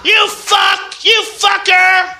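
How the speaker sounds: high, loud hammed-up rant of fuck you; mock furious yelling. Imitating a righteous attacker screaming abuse, hammed-up and loud. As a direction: scream it like an unhinged heckler.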